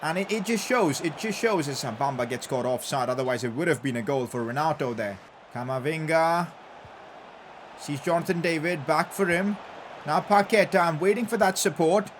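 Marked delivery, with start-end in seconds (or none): none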